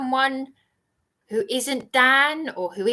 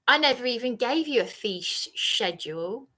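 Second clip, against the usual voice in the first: ordinary talk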